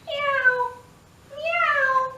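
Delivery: high, sweet meow; cat meowing. Acted. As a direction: mew like a little cat for the kids. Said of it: "Here comes the cat".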